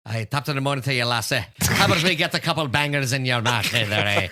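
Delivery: hammed-up Irish brogue, joking; Irish accent, playful. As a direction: put on a cheeky Irish brogue for the friends. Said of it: "All right, go".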